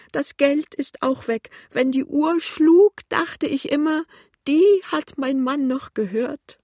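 put-on high, loud, tearful old woman; plaintive wail. A narrator's reading. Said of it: "sagt die klagende Stimme". (laute, hohe, weinerliche Klage)

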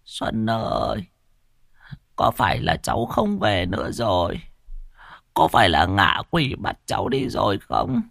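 put-on old woman pleading for Xuân to come home; grandmother calling. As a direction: call out for the lost grandson. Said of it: "rồi lại hỏi".

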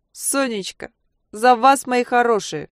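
high, tipsy tender toast; drunk toast. A narrator's reading. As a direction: toast them, slurring. Drunk aunt toasting again.

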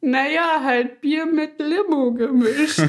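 high, slow, sheepish imitation; ashamed. Embarrassed customers explaining beer with lemonade, mock sheepish. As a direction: shrink into a drawn-out embarrassed plea. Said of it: "wir gucken so richtig beschämt".

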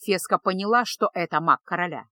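ordinary delivery

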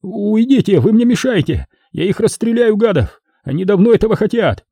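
high-pitched angry protest; high, frantic threat; agitated rant. A narrator's reading. Armed man rants at those intervening.

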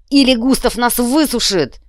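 high, loud roared threat; roaring. A narrator's reading. Тедди warns his men of Густав's punishment, roaring. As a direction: bellow the warning to the troops. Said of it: "взревел Тедди".

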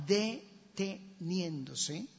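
"deteniéndose" broken slowly into separate syllables; emphasized word. Shown as a demonstration. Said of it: "subraye esa palabra". ("deteniéndose" recalcado sílaba por sílaba)

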